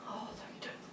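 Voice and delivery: whispered with effort; breathless whisper. Real, not acted. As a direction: gasp it out quietly. Exhausted in flow.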